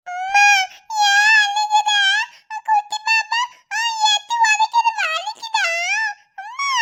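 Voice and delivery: put-on a small kid frightened by mother's pain; child anxiously asking mother why she cries; worried child. Child sees mother crying.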